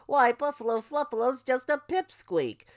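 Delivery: high-pitched teasing crow voice; grinning crow. Acted. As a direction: tease with a grin as a crow. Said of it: "with a grin on his beak".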